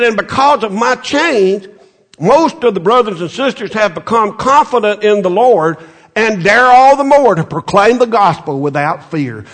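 rising sermon exhortation; full-force preaching. Acted. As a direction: preach it out with fire.

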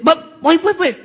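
high, urgent call to stop; high-pitched mock urgency; excited receptionist. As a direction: imitate her frantic calling back. Retold receptionist calling him back.

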